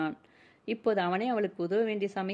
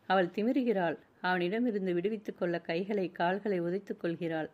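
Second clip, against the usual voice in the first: usual speaking voice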